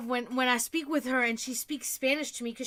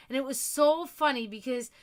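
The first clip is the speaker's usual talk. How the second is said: normal talking voice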